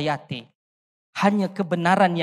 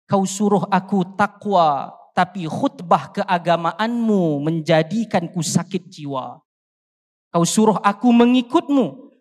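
rhythmic, rising protest poem read aloud; poem recitation. Acted. Reciting a protest poem to the audience, rhythmic and accusing. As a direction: recite it like a stage poem, building each accusation.

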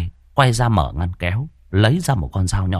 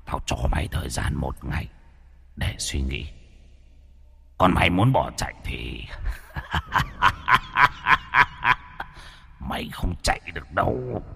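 softer, cold remembered threat; echoed threat; 5 dB quieter than usual. Retold or imitated. The threat ringing in Thắng's head as he runs, quiet menace.

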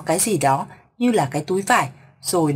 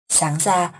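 ordinary delivery

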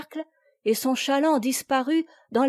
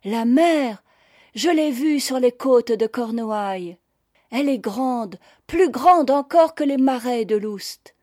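lifted voice, growing louder toward the end; enthusiastic; 4 semitones higher, 5 dB louder than usual. A narrator's reading. Young Chantepie remembering the sea at Cornouailles, enthusiastic. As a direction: marvel aloud at how vast it is. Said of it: "dont le jeune visage rayonna d'enthousiasme".

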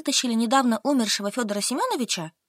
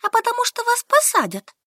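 put-on higher, stubborn young woman; Solka answering; 13 semitones higher, 4 dB louder than usual. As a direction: answer flatly, digging in. Solka retorts. (выше, упрямая Солька)

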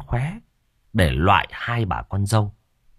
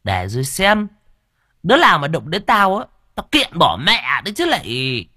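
higher, cocky muttered threat; smug threat; 7 semitones higher, 3 dB louder than usual. Acted. Cúc gloats over the fenced land, smug threat. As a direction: gloat to yourself, daring anyone. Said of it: "mụ chống nạnh cười thầm".

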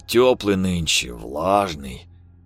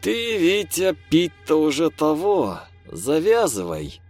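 disapproving; 8 semitones higher than usual. Acted. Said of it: "Неодобрительно поморщился Михалыч".

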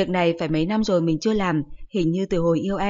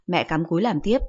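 plain reading voice

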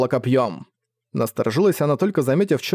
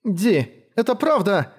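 amazed joy; 7 semitones higher, slower than usual. A narrator's reading. Overjoyed at rescuer's arrival.

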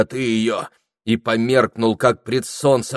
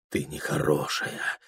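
low, soft dreamer's murmur; faint sleep murmur; 6 semitones lower, 6 dB quieter than usual. A narrator's reading. Прохор murmuring in his sleep, faint murmur. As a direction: barely voice it, as if asleep. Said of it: "еле шептали во сне его губы".

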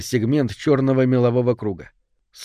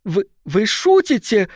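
shrieking; 9 semitones higher, 3 dB louder than usual. Acted. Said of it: "завизжала леди Саттон".

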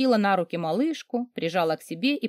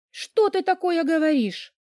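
high, hurt protest; hurt disbelief; 10 semitones higher than usual. A narrator's reading. Daughter answers her mother's insults, high and hurt. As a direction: voice lifts high in dismay.

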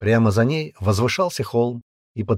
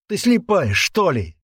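high exasperated yell; angry yell; 9 semitones higher, 4 dB louder, slower than usual. Acted. Man to the girl by the window, angry yell. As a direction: yell it at her in frustration. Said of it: "заорал он".

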